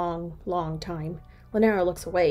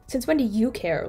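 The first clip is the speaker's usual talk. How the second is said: bitter question; 5 semitones higher, 6 dB louder than usual. Acted. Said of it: "Lanera asks bitterly".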